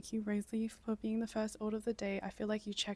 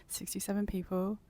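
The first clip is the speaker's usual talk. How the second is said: normal talking voice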